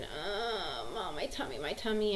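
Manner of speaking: put-on soft, drawn-out groan of a child; lightly mimicked whimper to Mom.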